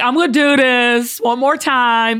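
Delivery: slow, drawn-out mock announcement; lightly mimicked stage announcement. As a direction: stretch it out, imitating a performer giving in. Imitates comedian's weary announcement onstage.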